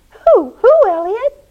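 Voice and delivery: high, loud, eager question; alarmed, high-pitched questioning; urgent asking. Acted. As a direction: demand to know in a high voice. Pete urgently asks who.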